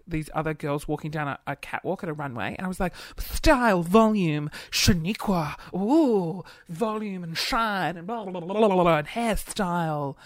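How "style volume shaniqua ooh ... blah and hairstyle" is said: hammed-up campy salon commentary; showy, sing-out hairdresser act; flamboyant hairdresser. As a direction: imitate a flamboyant hairdresser cooing over a catwalk.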